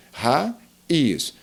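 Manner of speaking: raiz broken into two syllables, ra... iz; teacher separating the vowels of raiz; hiatus split. Shown as a demonstration. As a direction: say raiz with a clear gap between "ra" and "iz". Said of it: "não temos as duas vogais na mesma sílaba".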